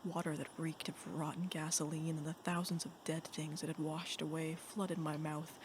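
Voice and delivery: ordinary delivery, nothing marked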